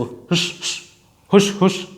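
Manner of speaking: hushing sound; shushing. Shown as a demonstration. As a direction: shush like keeping a secret. Shushing to show secrecy.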